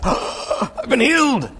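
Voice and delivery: high, drawn-out exultant cry; hammed-up gasping elation, stretched out; overjoyed amazement. Healed leper announces his miracle, hammed-up joy.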